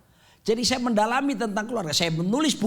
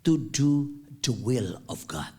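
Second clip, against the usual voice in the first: slow solemn statement; 8 semitones lower, slower than usual. Acted.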